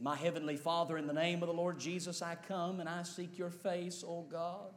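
hushed, pleading prayer; earnest prayer. Real, not acted. Leads closing prayer.

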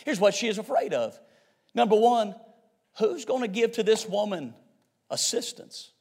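very soft, with long pauses; hushed preaching. Real, not acted. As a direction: let the question sink in quietly. Hushed build to key question.

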